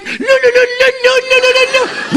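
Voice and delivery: very high trilling le le le; high birdsong trill; lark imitation. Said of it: "je fais l'alouette comme ça".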